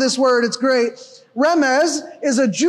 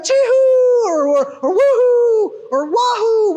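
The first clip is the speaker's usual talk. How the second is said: high, hammed-up party whoops; mock cheer sounds; 8 semitones higher, 3 dB louder, slower than usual. Shown as a demonstration. Preacher mocking a party cheer, high whoops. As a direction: whoop it up like a crowd at a game. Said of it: "It doesn't mean something like that".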